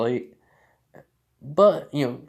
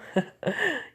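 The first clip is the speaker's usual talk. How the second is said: short high-pitched chuckle; amused character's brief laugh; acted laugh. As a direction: let out a little high laugh. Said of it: "Mori just laughs".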